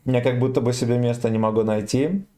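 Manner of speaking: usual speaking voice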